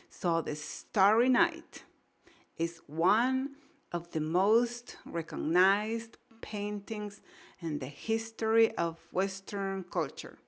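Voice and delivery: very slow, word by word, each syllable clear; first-time read-through, slow and deliberate; slow careful reading. Shown as a demonstration. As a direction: read "The Starry Night" slowly, sounding out every word. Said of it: "te tomes tu tiempo".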